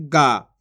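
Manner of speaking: गा with a long, held vowel; drawn-out vowel. Shown as a demonstration. Teacher showing how the matra is read, गा drawn out. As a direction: stretch out the end of गा. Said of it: "इसको खींच के पढ़ेंगे".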